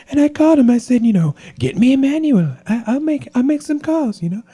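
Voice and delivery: a radio host mocks Trump's whisper thing; Trump impression. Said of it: "do the whisper thing".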